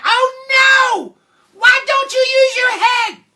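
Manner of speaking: very high, loud screech; shrieking mother. Acted. Mother confronting buttered Jack, shrieking. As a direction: shriek it in hammed-up horror.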